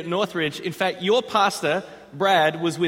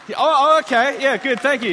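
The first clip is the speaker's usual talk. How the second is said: excited; 6 semitones higher, 5 dB louder than usual. Real, not acted. Surprised by crowd cheer.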